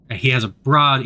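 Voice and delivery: ordinary delivery, nothing marked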